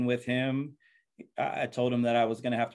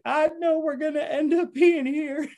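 much higher, tearful half-laughing voice; imitating wife crying; 4 dB louder than usual. Wife in the sanctuary, tearful and laughing, much higher. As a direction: mimic his wife laughing through tears. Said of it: "bawling again".

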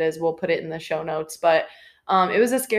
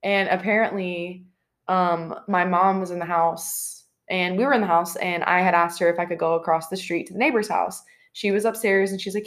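ordinary talk

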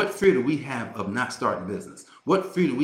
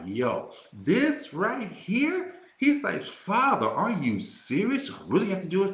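Jesus pleading with Father; 7 semitones higher than usual. Retold or imitated. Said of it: "He was like".

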